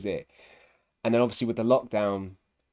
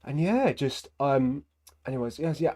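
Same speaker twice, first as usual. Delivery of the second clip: ordinary talk, nothing marked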